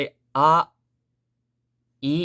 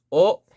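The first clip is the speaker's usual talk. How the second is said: "o" held level on a higher note; flat high note; 6 semitones higher, 6 dB louder than usual. Shown as a demonstration. Teacher showing where the note starts, "o" high and level. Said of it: "So o flat here".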